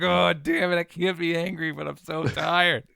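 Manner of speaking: loud, high, laughing complaint; fake-angry but melting, laughing; loud laughing mock frustration; laughing exasperation. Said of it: "and you're like".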